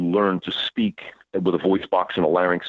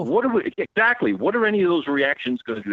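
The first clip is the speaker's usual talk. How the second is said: high, disbelieving question; incredulous; 10 semitones higher than usual. Real, not acted. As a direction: sound baffled by the idea. Mocking panic reactions.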